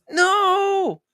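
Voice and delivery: very high, drawn-out denial; wailed protest. Real, not acted. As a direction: wail it out in protest. Rejecting her accusation.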